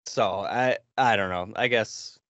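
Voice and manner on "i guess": usual speaking voice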